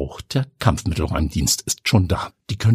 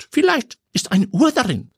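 put-on much higher, boyish voice; young nephew voice; 11 semitones higher than usual. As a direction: play the chirpy young nephew. Neffe erklärt das Paket. (viel höhere, jungenhafte Stimme)

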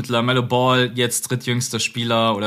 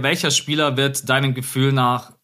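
ordinary delivery

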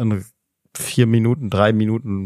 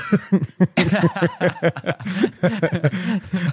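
high, helpless laughter; prolonged real laugh; long laughing fit; 7 semitones higher than usual. Real, not acted. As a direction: crack up at your own joke. Cracks up at own joke.

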